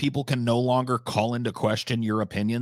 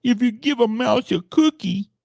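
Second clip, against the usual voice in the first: high, louder mocking singsong of the title; 10 semitones higher, 4 dB louder than usual. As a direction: sneer the kids' book title up high. Mocking the video's kids' reference.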